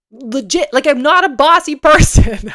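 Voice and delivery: loud, high protest breaking into laughter; laughing protest. Real, not acted. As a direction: protest loudly, cracking up by the end. Protesting being called bossy, laughing.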